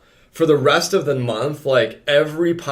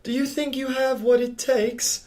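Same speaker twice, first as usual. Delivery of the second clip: put-on posh accent; 5 semitones higher, 4 dB louder than usual. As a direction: ham up a snooty accent to a gym client. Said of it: "Maybe I just need an accent".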